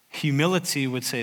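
ordinary delivery

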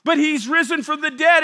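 full-force sermon delivery; preaching. Acted. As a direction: proclaim the resurrection.

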